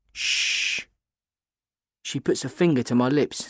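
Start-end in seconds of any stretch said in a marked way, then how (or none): 0.1-0.9 long voiceless shushing sound; hushing shh. A narrator's reading. Kat silencing the narrator among French kids, with a shh. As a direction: hush someone with a drawn-out "shh". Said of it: "silencing me".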